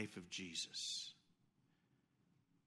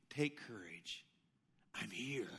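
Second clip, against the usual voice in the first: much softer, low, gentle; tender reassurance; 7 semitones lower, 9 dB quieter, slower than usual. Acted. Jesus calming his frightened disciples, tender and soft. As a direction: soothe them quietly.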